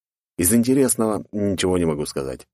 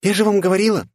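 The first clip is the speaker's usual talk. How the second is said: put-on high, louder smug girl voice; pleased girl; 13 semitones higher, 4 dB louder, faster than usual. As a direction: say it smugly, told-you-so. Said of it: "заявила довольная Присцилла". (довольная девушка, высоко)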